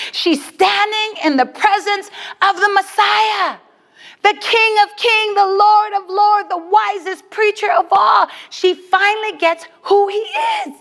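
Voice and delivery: high, sustained preacher's build; impassioned preaching. Real, not acted. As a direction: proclaim each title with rising fervour. Preaches at climax.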